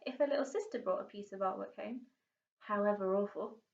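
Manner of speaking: ordinary delivery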